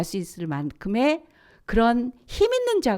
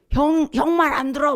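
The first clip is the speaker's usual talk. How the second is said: higher, whiny mock kid protest; mock twin brother; 8 semitones higher, 4 dB louder than usual. Preacher imitating a twin claiming seniority, higher whiny kid voice. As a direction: whine it like a bossy twin brother. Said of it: "막 이래".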